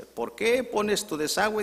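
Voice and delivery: high, drawn-out angry complaint; neighbour shouting. Acted. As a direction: act out a neighbour yelling an accusation. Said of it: "y viene gritando". (reclamo a gritos de vecino)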